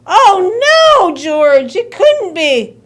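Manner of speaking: loud, high cry of dismay; mother's shocked outburst; horrified disbelief. Acted. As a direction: wail it in mock horror.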